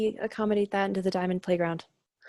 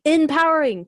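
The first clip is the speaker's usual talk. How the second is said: emphatic exclaim; 7 semitones higher, 4 dB louder than usual. Real, not acted. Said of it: "emphatic single-word exclamation".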